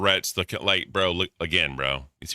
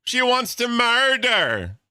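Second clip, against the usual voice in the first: high, stretched-out mock gasp; mocking echo; 5 dB louder, much slower than usual. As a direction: repeat her word back in an exaggerated high slow voice. Mocking the streamer's murder talk.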